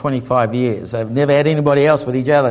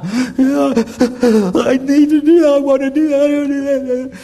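high, slow, weepy I need to do that; imitating a big man breaking down in tears; sobbing man; 10 semitones higher, 3 dB louder than usual. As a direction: plead it through sobs.

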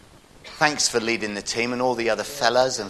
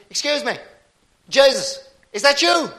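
high, loud call across a pub; raised voice hailing a stranger; 6 dB louder than usual. Acted. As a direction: holler over to someone in the corner. Irishman calling across the pub in joke.

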